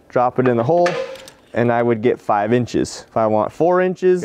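ordinary talk

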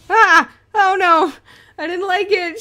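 high, loud panicked yelling; startled screaming in real fright; terrified scream. Real, not acted. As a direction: shriek at the jump scare. Jump scare in horror game.

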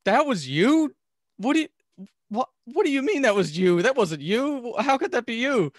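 high, loud incredulous voices; can't believe what they're hearing; shocked disbelief. Retold or imitated. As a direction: principals reacting in disbelief to the honest kid. Principals shocked at confession.

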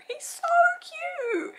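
tiny high squeal of adoration; squeaky gush. Real, not acted. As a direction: squeak it out, too cute to speak. Gushing over cute pug.

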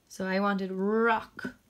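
slow, careful teacher delivery; slow sounding out. Shown as a demonstration. Teacher to pupils, slowly modelling the word. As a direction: stretch it out so the class can follow. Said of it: "Sound it out".

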